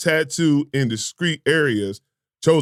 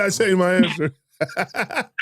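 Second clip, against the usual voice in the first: drawn-out, higher mock quote through laughter; mocking imitation; 5 semitones higher, much slower than usual. Host teases friend for switching answers, laughing mimicry. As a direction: laugh while mimicking his backtrack, very slow. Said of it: "He said".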